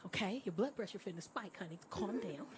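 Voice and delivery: quietly reassuring a worked-up date; soothing. Retold or imitated. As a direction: settle him down gently, honey. Soothing an agitated old man.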